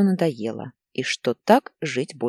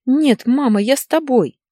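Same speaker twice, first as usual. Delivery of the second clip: put-on high, loud little-boy plea; upset child; 9 semitones higher, 7 dB louder than usual. A narrator's reading. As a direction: cry out to your mother like a child. Frightened child runs to mother. (взволнованный детский возглас)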